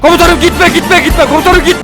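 high, loud put-on plea of a frightened soldier; mock pleading soldier. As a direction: beg the commander not to go, hammed up.

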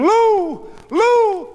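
very high, loud scream of a name. A narrator's reading. Goldie racing toward the stretcher, screaming. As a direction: scream for a husband in panic.

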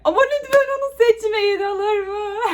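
high, whiny plea; mock pleading. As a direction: beg like a child, half joking. Pleading playfully to viewers. (yalvaran çocuk sesi)

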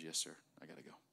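low, soft, obedient reply; submissive cancer. Acted. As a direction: give in meekly, voice dropped low. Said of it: "And the cancer says".

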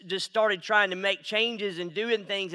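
usual speaking voice

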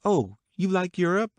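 high, pleasantly surprised question; surprised exclamation. A narrator's reading. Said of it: "she exclaimed surprisingly".

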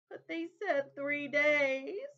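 high, loud, very slow tearful protest; cling to the promise through sobs; child crying protest. A narrator's reading. Sobbing little girl protesting to her brother, high and slow.

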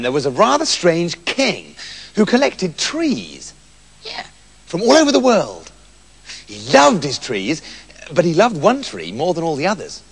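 plain reading voice

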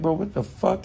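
a hint of stunned friend; mock disbelief. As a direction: throw up your hands in disbelief. Retold reaction.

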